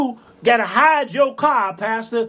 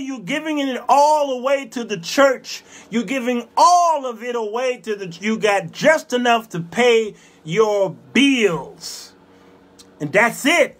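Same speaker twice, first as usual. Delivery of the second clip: ordinary talk, nothing marked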